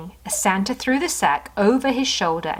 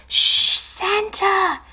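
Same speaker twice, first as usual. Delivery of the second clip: much higher, slow hush; sleepy kitten; 11 semitones higher, much slower than usual. Acted. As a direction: hush him drowsily. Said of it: "said the kitten".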